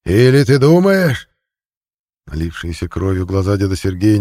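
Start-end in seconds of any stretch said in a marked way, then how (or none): 0.0-1.3 slow, higher, louder challenge; accusing shout. Acted. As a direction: hurl the question at him. Preacher confronts the captive.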